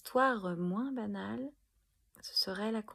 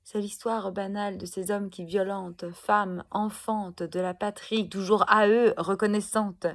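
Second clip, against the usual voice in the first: loud, raised slam crescendo; furious declamation; 3 semitones higher, 10 dB louder than usual. Acted. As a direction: drive it out loud in long angry runs. Poem's angry climax against honoured abusers. (déclamation forte et en colère)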